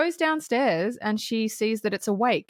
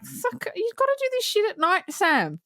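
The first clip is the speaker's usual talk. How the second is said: high, exasperated outburst at the director; high exasperated plea to the director; 11 semitones higher than usual. Retold or imitated. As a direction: throw your hands up at Sam. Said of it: "And I'm like".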